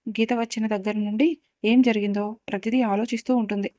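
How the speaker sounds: plain reading voice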